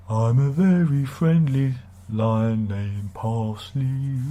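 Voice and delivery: slow, lightly voiced cartoon lion; Parsley the lion. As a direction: gently croon like a kids' TV lion. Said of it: "You know, was it the Chives?".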